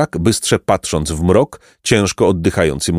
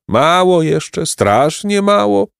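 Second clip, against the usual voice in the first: high, drawn-out peasant whine; playful lament; 11 semitones higher, slower than usual. A narrator's reading. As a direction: complain with a smile, stretching the words slowly. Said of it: "żalił się uśmiechnięty chłop".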